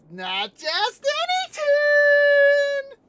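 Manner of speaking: very high, loud, drawn-out villain cry; Judge Doom. Retold or imitated. Villain revealing his true nature, slow screeching menace. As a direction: stretch the last word into a piercing screech. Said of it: "and Judge Doom goes".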